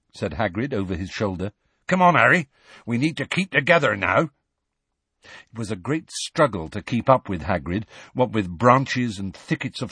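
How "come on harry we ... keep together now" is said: higher, louder rustic giant; urging. A narrator's reading. Hagrid urges Harry to keep up, urging. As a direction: chivvy him along.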